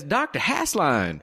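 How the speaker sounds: slow, drawn-out Southern accent, hammed up; deliberately bad Southern accent, slowed way down. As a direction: put on your worst Southern accent.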